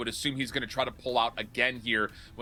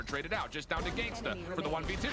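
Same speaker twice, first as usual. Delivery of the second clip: excited play-by-play; 4 semitones higher, 4 dB quieter than usual. Real, not acted. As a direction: shout the clutch call. Caster calls the play.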